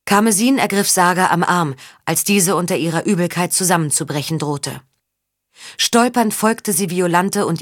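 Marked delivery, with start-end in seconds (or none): none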